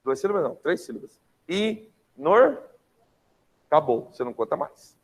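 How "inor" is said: "Inor-" in separate raised, louder beats; counting syllables. Shown as a demonstration. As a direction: say "Inor-" as I... NOR, one beat each. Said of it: "contar até a última sílaba tônica".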